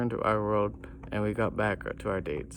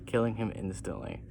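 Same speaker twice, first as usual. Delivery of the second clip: usual speaking voice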